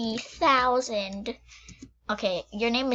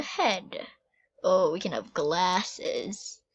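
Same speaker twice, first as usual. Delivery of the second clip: usual speaking voice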